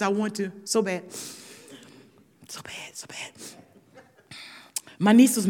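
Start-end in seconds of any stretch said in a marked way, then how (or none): 2.5-3.3 whispered aside, barely voiced. Real, not acted. Whispering to the audience how badly she wanted to lie. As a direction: breathe it to the crowd like a secret.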